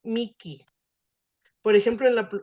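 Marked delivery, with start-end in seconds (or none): 0.0-0.6 "Miki" with a clear hard k. Shown as a demonstration. Teacher repeats alternative pronunciation for class, "Miki" with hard k. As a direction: isolate "Miki", k in place of the aspiration. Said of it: "esa pronunciación para el Miji".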